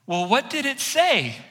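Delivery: high, slow actress ad-lib; Annie's line. Retold or imitated. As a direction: ask it high and slow, stalling. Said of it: "looked at me and goes".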